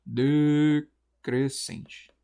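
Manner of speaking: "decrescente" stretched slowly, syllable by syllable; drawn out by syllables. Shown as a demonstration. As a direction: sound out "de-cres-cente" very slowly. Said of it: "eu identifico que é um ditongo".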